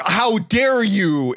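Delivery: imitating an outraged witness; mock affronted shock. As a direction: stretch it out in scandalised offence. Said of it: "are shocked, and the guy's like".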